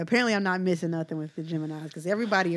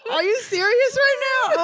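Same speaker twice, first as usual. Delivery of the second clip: high, loud amused disbelief; can't believe she's still laughing; 9 dB louder than usual. Real, not acted.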